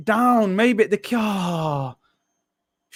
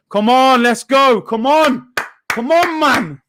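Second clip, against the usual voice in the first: louder, rising calls of encouragement; repeated loud calls building higher; fired-up urging; 5 semitones higher, 5 dB louder, slower than usual. Real, not acted. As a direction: pump the team up. Urging his team on.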